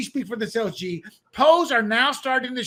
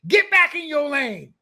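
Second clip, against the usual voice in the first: mock scolding; 7 semitones higher, 5 dB louder, slower than usual. Party attacking the mayor.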